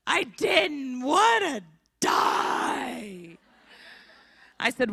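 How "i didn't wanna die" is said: put-on very slow, drawn-out child's wail; sulky boy explaining himself; whiny kid. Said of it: "what did that even mean".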